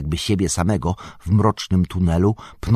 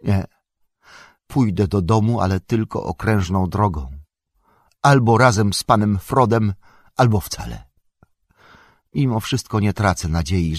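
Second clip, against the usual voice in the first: upset resolve, raised voice, a touch of tears; fighting back tears; 5 semitones higher, 4 dB louder than usual. A narrator's reading. Sam resolves to stay with Frodo, mildly choked and upset. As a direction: speak through a choked-up feeling, growing firmer. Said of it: "przemówił zdławionym głosem, jakby walcząc ze łzami".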